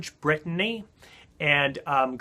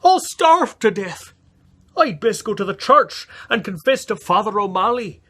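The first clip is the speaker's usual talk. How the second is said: higher, louder hammed-up lament; self-pitying lament; 7 semitones higher, 7 dB louder than usual. Jamie fearing starvation, loud melodramatic lament. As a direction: moan about starving. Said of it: "wailed Jamie".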